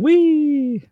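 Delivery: high, drawn-out squeal of wee; yelled glee. Retold or imitated. Host imitates them yelling, high long gleeful whoop. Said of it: "and yelling".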